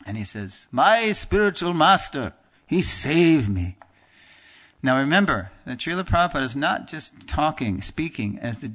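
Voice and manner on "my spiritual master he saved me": slow, higher and louder, voice full of feeling; very emotional. Retold or imitated. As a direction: let the gratitude swell as you slow down. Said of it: "Prabhupada gets very emotional".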